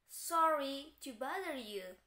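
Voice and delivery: Sorry to bother you drawn out slowly; teacher demonstrating an apology; slow apologetic model. Shown as a demonstration. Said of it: "contohnya lagi ada seperti ini".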